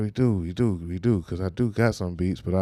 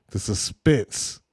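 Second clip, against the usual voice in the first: drawn-out mock suspense; much slower than usual. Host reacts to the clip.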